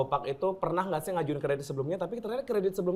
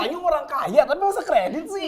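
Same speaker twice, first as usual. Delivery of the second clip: laughing; 9 semitones higher than usual. Real, not acted. Host teases laughing.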